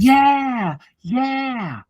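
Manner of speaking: imitating a fan cheering like a moron; mock cheering. Man mocking party fans at conventions, a high hammed-up cheer.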